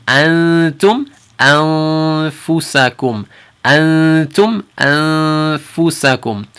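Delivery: antum anfusakum, slow with nasal an; careful Quran recitation; slow ikhfa demo. Shown as a demonstration. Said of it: "If you can hear it".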